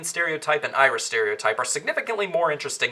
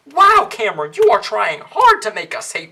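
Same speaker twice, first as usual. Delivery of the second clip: sarcastic; 4 semitones higher, 7 dB louder than usual. Acted. Sarcastic jab at the director.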